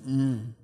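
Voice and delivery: low contented hum like a beagle; imitating a dog's happy groan. Pastor imitating his beagle Charlie's contented groan. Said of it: "he lets out this happy groan".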